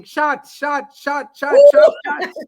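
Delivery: put-on drunk yelling shots; shouting. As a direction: yell it like a rowdy drunk. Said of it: "start screaming".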